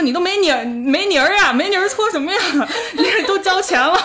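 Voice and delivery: high-pitched incredulous protest, breaking into laughter; imitated baffled attendant. Bath attendant to customer with no dirt to scrub, mock exasperated.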